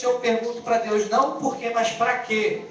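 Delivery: ordinary talk, nothing marked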